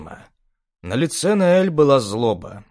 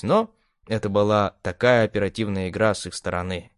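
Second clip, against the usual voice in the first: plain reading voice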